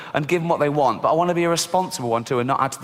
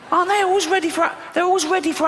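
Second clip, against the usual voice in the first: very high, squeaky rant; high-pitched exasperation; 14 semitones higher, faster than usual. Acted. Comic ranting to audience about spiders, squeaky exasperation. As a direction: let the voice shoot up into a squeal.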